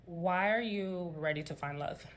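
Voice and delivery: ordinary talk, nothing marked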